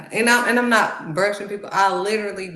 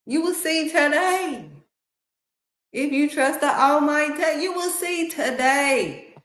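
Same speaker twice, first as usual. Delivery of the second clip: preaching shout; 9 semitones higher than usual. Real, not acted. Speaker promising listeners deliverance, preaching shout. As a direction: call it out like a preacher.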